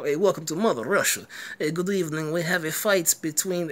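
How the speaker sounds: badly faked Russian accent; hammy, not-quite-Russian accent, welcoming the audience; shaky attempt at Russian accent. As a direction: play a Russian fight announcer with a dubious accent. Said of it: "was a Russian accent".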